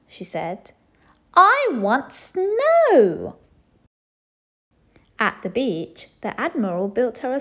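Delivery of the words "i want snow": high, loud, very slow drawn-out whine; whiny demand. A narrator's reading. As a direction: stretch the refrain out.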